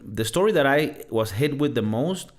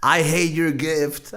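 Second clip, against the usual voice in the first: slow, drawn-out menacing joke; mock villain line; 4 semitones higher, much slower than usual. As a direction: ham up the evil father. Joking dark twist, villain line.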